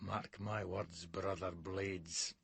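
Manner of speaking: a low, slow murmur of revenge; hushed vow. A narrator's reading. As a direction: keep the threat down under the breath. Hushed threat continues to Bladesy.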